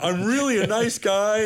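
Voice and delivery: high, pleading self-defence; imitating an anxious appeaser; mock hand-wringing. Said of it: "hand wringing".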